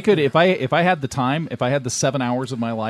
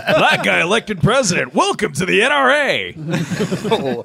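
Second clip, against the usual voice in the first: game announcer; 6 semitones higher, 4 dB louder, slower than usual. Pretend. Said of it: "It was".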